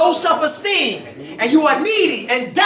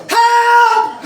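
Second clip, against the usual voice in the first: a high, hammed-up yell for help; mock cry for help; 9 semitones higher, 6 dB louder than usual. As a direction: squeal it like she's being chased, laughing. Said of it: "She said".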